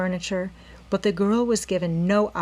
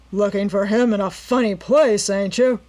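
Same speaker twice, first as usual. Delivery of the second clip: louder, mocking disbelief; sneering; 2 semitones higher, 6 dB louder than usual. A narrator's reading. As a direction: jeer at her lame excuse. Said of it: "sneered the man".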